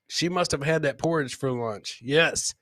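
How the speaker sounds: ordinary delivery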